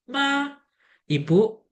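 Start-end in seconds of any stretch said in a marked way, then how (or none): 0.1-0.5 ma high and level; first tone. Shown as a demonstration. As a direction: hold ma on a steady high note. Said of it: "Nada pertama".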